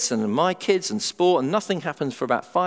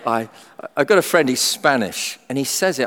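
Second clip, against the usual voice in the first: usual speaking voice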